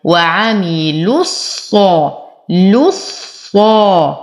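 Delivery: slow وعملوا الصا, the sad doubled; tajweed recitation. Shown as a demonstration. Teacher shows the meeting of two sukuns. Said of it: "إلى تجديد الصاد".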